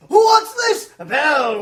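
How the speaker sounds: high, loud surprised call; jovial loud outburst; booming exclamation. Acted. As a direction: boom across the party. Fezziwig booming interruption.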